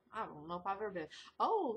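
ordinary talk

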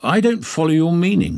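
high-pitched, mildly affronted reply; bristling. A narrator's reading. Holmes answers Pankhurst's hint about the lady, bristling. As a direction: bristle politely at the insinuation. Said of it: "Holmes bristled".

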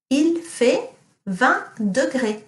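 Il fait vingt degrés repeated slowly; teacher repeating Il fait vingt degrés for pupils; slow model sentence. Shown as a demonstration. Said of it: "what does it mean if I say".

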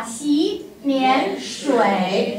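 洗脸水 stretched out syllable by syllable as a model; tone sandhi demo. Shown as a demonstration. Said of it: "后面一个保持不变啊".

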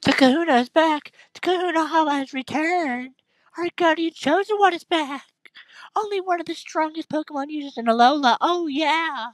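squeaky cheering crowd of locals; high-pitched townsfolk. Acted. Voices townsfolk dialogue.

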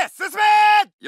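high, loud shout to advance; battle cry of advance; charge order. Acted. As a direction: bellow the men forward.

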